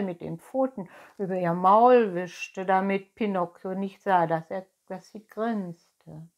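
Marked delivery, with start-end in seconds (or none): none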